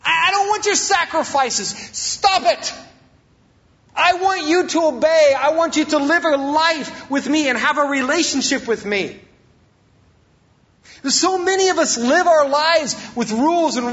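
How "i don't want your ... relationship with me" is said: high, insistent divine rebuke; forceful and high, pleading for obedience; God. Acted. Preacher voicing God rejecting sacrifices, exasperated and insistent. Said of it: "He said".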